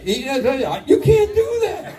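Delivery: high, drawn-out mock objection; mock protest. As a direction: stretch it out high in hammed-up protest. Voices shouted objection in his story.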